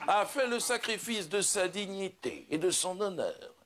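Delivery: slow, grandiose stage declamation; solemn proclamation. Acted. Judas revealing himself to the humans, solemn proclamation. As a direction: declaim it like a grand revelation, drawing out phrases.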